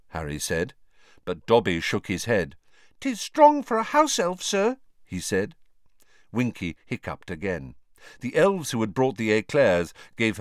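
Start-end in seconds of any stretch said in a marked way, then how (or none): 3.0-4.8 house elf. A narrator's reading. Dobby corrects Harry.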